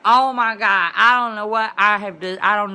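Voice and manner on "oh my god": loud, slow retold groan of disgust; sick oh my God. Telling how the sandwich made her sick, a loud slow groan. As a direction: wail it out like you're about to be sick. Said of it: "And I was like".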